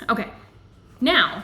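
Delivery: usual speaking voice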